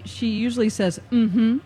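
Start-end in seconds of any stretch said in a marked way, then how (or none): 1.1-1.6 dismissive, louder mm-hmm; a curt brush-off as an assistant; bitchy Siri. Retold or imitated. User imitates her unhelpful Siri, bitchy. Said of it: "You have bitchy Siri?".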